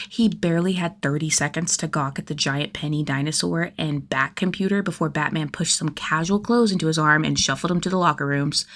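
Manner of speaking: plain reading voice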